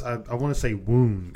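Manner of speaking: "wound" said WOOND; long oo vowel in "wound"; wound like an injury. Shown as a demonstration. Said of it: "'cause it's spelled the same".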